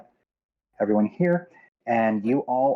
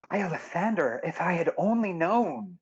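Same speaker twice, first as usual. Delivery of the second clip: dismayed; 7 semitones higher than usual. Acted. Captain hears news of her niece, dismayed. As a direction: lament it with rising regret.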